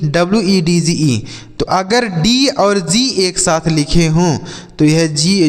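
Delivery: normal talking voice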